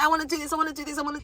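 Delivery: eager newcomer, high-pitched and breathlessly repetitive; hyped up. Acted. Newcomer hyped on Ghana, acted excitement. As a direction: mimic someone bursting to do everything. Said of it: "so hyped on a hundred".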